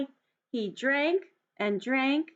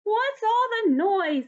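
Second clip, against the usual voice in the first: high, louder little mouse voice, slow; squeaky mouse; 10 semitones higher, 5 dB louder, slower than usual. Acted. Mouse woken by the noise, squeaky and cross. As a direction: squeak it out like a tiny annoyed mouse. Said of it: "Mouse asked".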